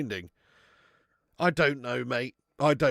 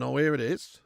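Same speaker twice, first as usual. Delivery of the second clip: ordinary talk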